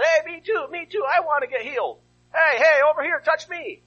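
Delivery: high, loud pleading call; begging to be noticed over the crowd. Acted. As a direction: shout after him from the crowd. Blind men calling after Jesus.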